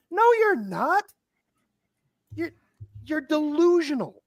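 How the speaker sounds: very high, incredulous rebuttal; exasperated disbelief. Real, not acted. Answering Seer's claim of playing chess, exasperated and incredulous. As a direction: protest high-pitched, stretching DELUSIONAL in disbelief.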